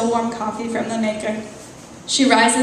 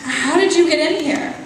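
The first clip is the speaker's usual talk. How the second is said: higher, alarmed; frightened woman questions the intruder, higher; panicked question; 6 semitones higher, 3 dB louder than usual. Acted. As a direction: ask it in a rush of fear.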